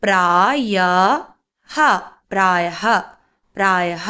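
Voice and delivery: പ്രായഹ stretched into "praa-ya-ha", then joined; drawn-out pronunciation. Shown as a demonstration. Teacher modelling a word for learners, പ്രായഹ slowly broken then blended. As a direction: sound out പ്രായഹ slowly, ending in "ha". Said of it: "ഇനി ഇതെങ്ങനെയാണ് ഉച്ചരിക്കേണ്ടത്?".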